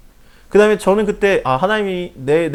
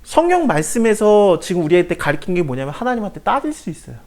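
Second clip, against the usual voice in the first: ordinary delivery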